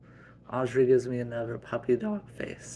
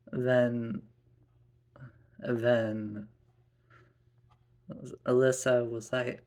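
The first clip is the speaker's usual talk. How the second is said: ordinary delivery, nothing marked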